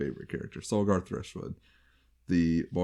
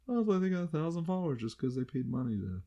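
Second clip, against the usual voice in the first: higher-pitched mock onlooker voice; imagined onlooker; 10 semitones higher, 4 dB quieter than usual. Podcaster voicing onlookers judging bought followers, in a high mock voice. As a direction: put on a high voice for someone commenting. Voicing what others might say.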